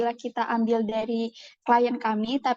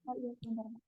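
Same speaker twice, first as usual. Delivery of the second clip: faint and far away; barely audible; 18 dB quieter, much faster than usual. Real, not acted. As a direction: voice too quiet to hear. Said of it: "Suaranya kecil banget".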